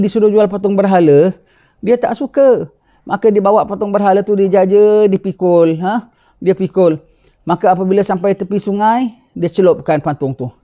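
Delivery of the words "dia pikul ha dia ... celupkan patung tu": ordinary delivery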